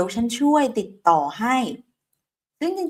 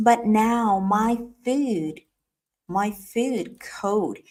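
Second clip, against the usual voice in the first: slow drill; much slower than usual. Shown as a demonstration. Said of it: "ถูกไหมคะ".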